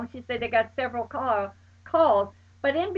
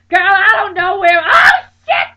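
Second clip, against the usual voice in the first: high, loud panicked screams and crying; panicked screaming; 8 semitones higher, 14 dB louder than usual. Retold or imitated. Friend reporting the car down the wrong way, panicked screaming. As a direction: shriek in terror, near tears.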